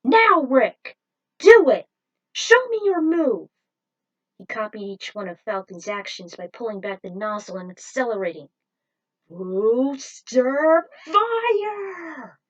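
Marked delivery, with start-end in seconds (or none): 0.0-3.5 loud, high rallying yell; commanding shout. Acted. Falcon signalling Rick to perform the move, loud commanding shout. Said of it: "Falcon gave him the signal". 9.3-12.4 very drawn-out, loud and high battle cry; anime attack call. Acted. Rick copying Falcon's move, long drawn-out shout.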